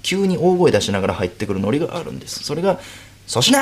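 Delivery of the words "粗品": high, loud cheery call of a name; mock loud greeting. Co-star bursting into the dressing room, loud cheery call. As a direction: call his name out brightly. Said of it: "って言いながら入ってくる".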